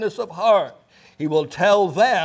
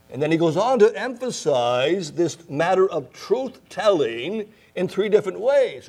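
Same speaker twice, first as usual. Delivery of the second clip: usual speaking voice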